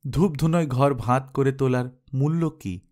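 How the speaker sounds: usual speaking voice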